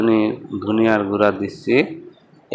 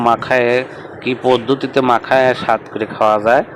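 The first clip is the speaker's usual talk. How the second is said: usual speaking voice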